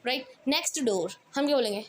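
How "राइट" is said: ordinary talk, nothing marked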